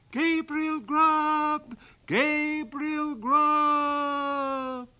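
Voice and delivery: very high, loud, drawn-out ghostly chant; wild chorus of voices. Acted. Goblin chorus calling the sexton's name, high and drawn out. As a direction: wail his name like a goblin choir.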